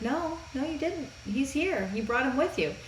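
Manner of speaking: soft, high Empress voice; gently reassuring. Retold or imitated. Empress reassuring the apologetic hero.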